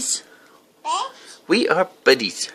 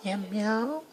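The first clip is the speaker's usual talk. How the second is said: soft, high playful meow; little high-pitched kitty meow; cat meowing; 6 semitones higher, 12 dB quieter than usual. Pretend. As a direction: meow softly like a cat for a child. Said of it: "What does a cat say?".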